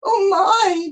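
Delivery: high, loud wail; groaning dismay. A narrator's reading. Jonah seeing the fish, groaning. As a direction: groan it out, hammed up. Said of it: "Jonah groaned".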